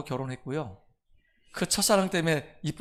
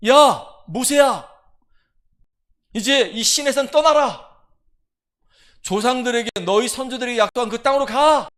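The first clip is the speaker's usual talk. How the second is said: God's booming command; 6 semitones higher, 10 dB louder than usual. Acted. God ordering Moses off Sinai, loud and commanding. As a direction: call out like God giving orders.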